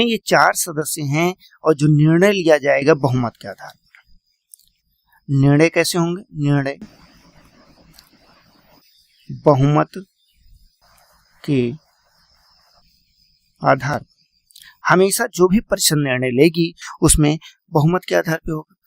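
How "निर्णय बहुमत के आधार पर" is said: word by word, long pauses between words; slow dictation. Shown as a demonstration. Teacher dictating notes to students, slow and spaced. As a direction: dictate each word so students can write it. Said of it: "उसको note करके लिख दीजिएगा।".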